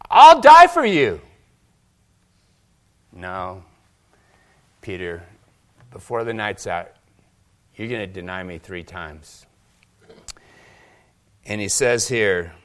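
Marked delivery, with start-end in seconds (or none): 0.1-1.2 loud, high, passionate promise; bold vow. Acted. Peter boasts to Jesus. 3.1-9.5 quiet warning. Acted. Jesus answers Peter calmly.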